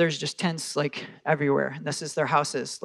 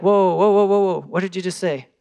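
loud, high startled whoa that settles back down; alarmed mind; 4 semitones higher, 8 dB louder than usual. Retold or imitated. The startled mind stopping the spirit, a loud high whoa. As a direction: play the mind slamming on the brakes. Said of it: "'Cause the mind's like".

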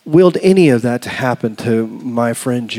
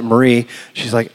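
ordinary talk, nothing marked

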